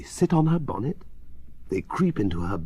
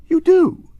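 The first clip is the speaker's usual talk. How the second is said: much higher, louder burst; exasperated outburst; 10 dB louder than usual. A narrator's reading. Father complaining that children disobey, exasperated.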